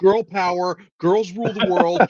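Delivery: high-pitched mock-giddy cheerleading; gleeful hype. Fan recalling walking into the movie, mock-giddy. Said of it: "sunshine was shooting out of my eyeballs".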